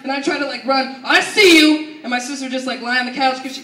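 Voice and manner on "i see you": loud acted "gotcha" call; imitating mom calling them out; mock mom yell. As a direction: holler it like a mom catching her kids. Mom bursting in, catching kids.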